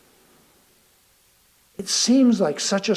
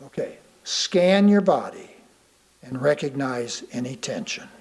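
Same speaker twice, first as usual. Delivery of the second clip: guided meditation; 5 semitones lower, 7 dB quieter than usual. Acted. Guiding listeners through a calming body scan, soft meditation voice. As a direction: ease the listener into calm.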